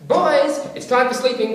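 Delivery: loud, high falling call. Retold or imitated. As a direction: announce bedtime to the room. Bed check calling out.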